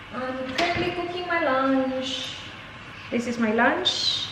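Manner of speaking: quiet. Real, not acted. Talking softly while baby sleeps.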